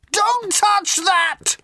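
very high, slow drawn-out yell. A narrator's reading. Ford yelling at Arthur not to touch the phone, very high and drawn out. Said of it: "yelled Ford".

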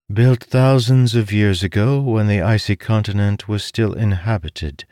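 a hint of a learned woman explaining; lightly voiced scholar. A narrator's reading. Bayham explaining history.

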